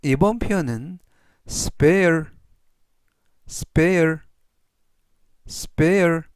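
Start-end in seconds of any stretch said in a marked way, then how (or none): 1.5-6.2 spare said slowly and clearly, three times; teacher modelling spare for learners to repeat; model pronunciation. Shown as a demonstration. Teacher drilling spare for the class, slow and clear. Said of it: "이라고 발음하시면 되겠고요".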